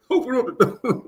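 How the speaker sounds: high squeaky shout; caricature voice. Pretend. The streamers at the door, high squeaky voice.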